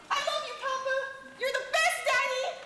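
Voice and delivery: put-on high squeaky child voice; little kid voice. King voices his small sons.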